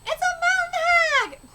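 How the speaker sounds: high, slow, strained shriek of fright; high drawn-out scared cry; terrified shriek. Acted. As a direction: shriek it like a boy who spotted a monster. Boy shrieking at old woman.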